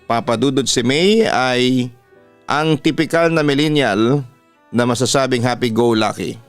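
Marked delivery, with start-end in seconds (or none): none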